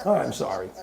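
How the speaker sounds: laughing at own pun; laughing apology. Real, not acted. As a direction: still laughing through the words.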